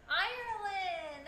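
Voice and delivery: high, slow drawn-out cheer; excited. Real, not acted. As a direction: stretch it out in delight.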